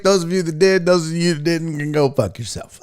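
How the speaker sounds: fast, punchy radio-host welcome back; brisk announcer patter, easing at the end; show host intro. Acted. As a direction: rattle off the intro at full energy.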